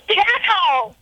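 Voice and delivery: high mock yell; imitated patient's scream. Nurse imitating a patient yelling at passers-by, high mock scream. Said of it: "she would start screaming".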